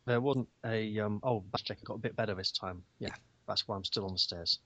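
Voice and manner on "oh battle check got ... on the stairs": ordinary delivery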